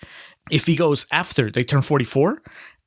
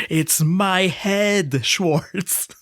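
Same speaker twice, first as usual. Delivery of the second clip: drawn-out, higher impression of an indignant actor; John Malkovich; 5 semitones higher, much slower than usual. Podcaster quoting the film to co-host, slow theatrical impression. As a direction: stretch it out in a put-on actor's voice. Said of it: "finally sounds like the Malkovich I know".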